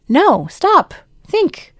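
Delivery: commanding halt; firm stop. Shown as a demonstration. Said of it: "coaching the listener".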